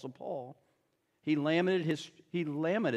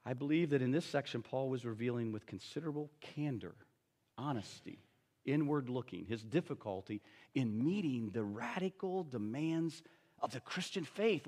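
quiet earnest restatement; 5 semitones lower, 8 dB quieter than usual. Shown as a demonstration. Pastor restating Paul's candor to the congregation, soft and earnest. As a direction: lean in and say it softly so it lands. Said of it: "Now think about this".

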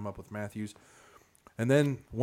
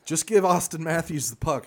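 higher, louder pumped-up call; excited; 7 semitones higher, 7 dB louder than usual. Real, not acted. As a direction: sell it like a sure thing. Joking hockey strategy tip.

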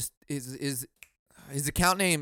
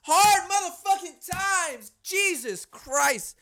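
much higher, louder, slow outburst; exasperated shout; 5 dB louder, slower than usual. Real, not acted. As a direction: blow up over the hard times. Bursts out over hard times.

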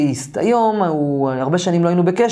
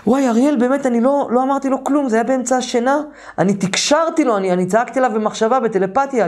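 high-pitched retold astonishment; suddenly remembering; 8 semitones higher than usual. Roommate realises he shouted by telepathy, in high-pitched astonishment. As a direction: act out a startling memory coming back. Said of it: "ואז הוא נזכר, אומר לי".

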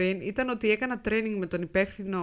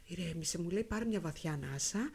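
soothing calm; 5 semitones lower, 9 dB quieter than usual. Retold or imitated. Calm colleague quoted.

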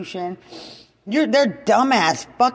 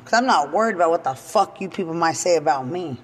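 ordinary talk, nothing marked

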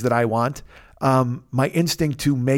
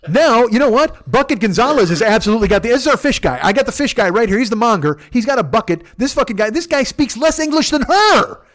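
high, loud exasperation; exasperated rant; 8 semitones higher, 5 dB louder than usual. Retold or imitated. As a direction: rant in mounting disbelief. Worked-up rant about the order.

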